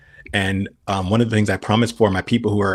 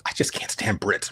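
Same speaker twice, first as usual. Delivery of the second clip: hushed, slow whispered aside; confiding whisper; 7 dB quieter than usual. Retold or imitated. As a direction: let it slip as a quiet secret. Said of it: "he's like".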